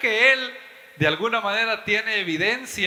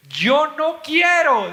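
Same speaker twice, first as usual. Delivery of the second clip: mock whiny refusal; 8 semitones higher, 7 dB louder, much slower than usual. Mocking the atheist's refusal.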